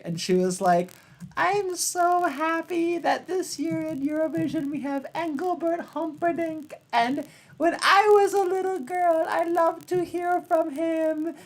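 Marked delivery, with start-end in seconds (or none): 1.4-11.4 put-on high, thin old British lady voice; a sweet elderly Brit gushing about her idol; little old lady. A little old British lady in a TV interview, high and gushing. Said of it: "this little old British lady".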